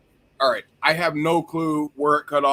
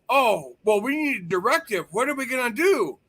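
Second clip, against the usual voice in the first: high-pitched mock dithering; mocking helplessness; 6 semitones higher than usual. Co-host mimicking police waiting for a directive, mocking helplessness. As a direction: imitate clueless officers waiting to be told.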